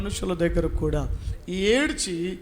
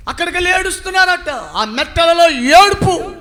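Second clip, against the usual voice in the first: shouted preaching; 10 semitones higher, 10 dB louder than usual. Acted. Preacher exclaiming over Moab's weeping, loud. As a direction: burst out and then drag the weeping.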